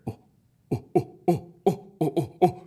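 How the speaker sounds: short high "oh" hoots; primitive hooting. Acted. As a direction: hoot like cartoon cave creatures. Stone wheels making primitive noises.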